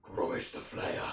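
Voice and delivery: slow whispered name; menacing whisper. Acted. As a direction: breathe the name slowly. Orc warrior names himself menacingly.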